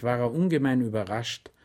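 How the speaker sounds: usual speaking voice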